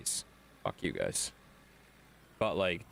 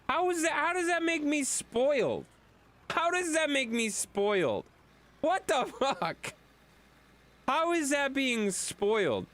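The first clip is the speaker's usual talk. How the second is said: indignant protest. Real, not acted. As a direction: protest the accusation, stretching it out. Protests being called spoiled.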